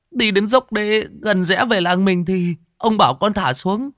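high, agitated pleading report; flustered underling pouring out the story; frightened servant. Acted. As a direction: explain in a loud, high, anxious voice. Frightened servant reports the drowning to mistress.

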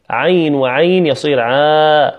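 slow, spelling out عين وعين into a long عا; counting the beats. Shown as a demonstration. Teacher counting madd length for students. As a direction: measure the two letters deliberately. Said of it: "كهنا مثلًا العالمين".

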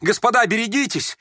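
high, urgent warning cry; alarmed shout. A narrator's reading. Boy Kolya seizing the youth's hand, shouting warning. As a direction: cry out to warn them all. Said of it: "крикнул Коля".

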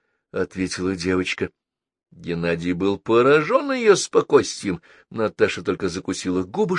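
plain reading voice

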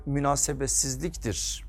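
normal talking voice

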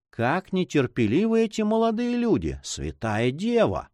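put-on high, exasperated old-woman grumble; lightly voiced grumbling old duenna. A narrator's reading. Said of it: "проворчала старуха".

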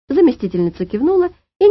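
ordinary delivery, nothing marked

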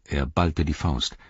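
ordinary delivery

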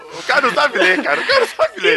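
cracking up mid-sentence; laughing while talking. Real, not acted. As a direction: talk through real laughter. Laughing at misreading reader.

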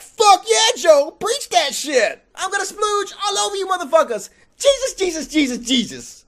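high, hammed-up gleeful Jesus; mock excited Jesus. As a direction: cheer on the preacher, over the top. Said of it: "Jesus like".

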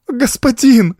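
put-on very high, wailing child's cry; tearful cry. A narrator's reading. Girl hanging on servant's back, crying out. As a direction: cry out through tears. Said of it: "сквозь слёзы кричала девочка".

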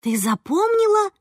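high, astonished question; wondering, pitched high; gasped amazement. A narrator's reading. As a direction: gasp it in delighted disbelief. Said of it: "ахнула я".

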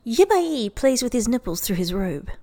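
ordinary delivery

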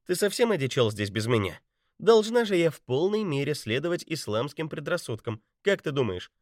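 high playful teasing woman; teasing woman's voice. A narrator's reading. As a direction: tease him lightly in her voice. Betty's voice, teasing.

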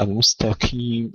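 المستقيم with qaf as a light "k", mustakim; thin the ق of المستقيم into ك; weakened qaf. Shown as a demonstration. Teacher showing others' mistake, weakened qaf in المستقيم. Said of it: "بعضهم يرققونها وبعضهم يهمسونها".

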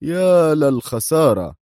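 high, drawn-out lament; slowly deplore the loss; dismayed exclamation. A narrator's reading. Minister dismayed at the confession.

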